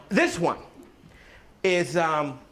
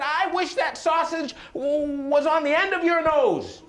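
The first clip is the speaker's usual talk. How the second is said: angry wish; 10 semitones higher than usual. Acted. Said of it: "She got so angry with him".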